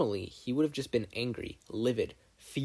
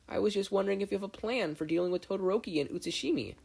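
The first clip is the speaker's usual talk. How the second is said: put-on higher, hesitant timid girl voice; 10 semitones higher, faster than usual. A narrator's reading. Shy partner asks grumpy Bakugo, timid and higher. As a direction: ask nervously, as if afraid of him.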